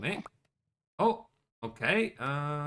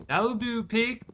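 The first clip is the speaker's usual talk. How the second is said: higher farmer voice quoting Babe; playful Babe farmer impression, voice pitched up; mock farmer quote; 5 semitones higher, slower than usual. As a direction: do the old farmer from Babe, gently pleased.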